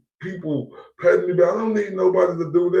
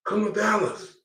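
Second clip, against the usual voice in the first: taunting invite; 6 semitones higher, 4 dB quieter than usual. Real, not acted. Challenging a rival.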